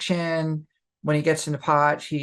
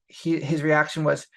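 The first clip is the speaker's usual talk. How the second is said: ordinary talk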